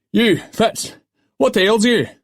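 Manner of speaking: loud, high-pitched demand; sharp demand. A narrator's reading. Ship's master rounds on an idle oarsman, loud demand. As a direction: call him out loudly across the deck. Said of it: "the master demanded".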